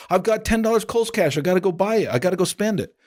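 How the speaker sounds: imitating wife. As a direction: play the excited wife itching to shop. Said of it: "My wife is addicted to Kohl's Cash".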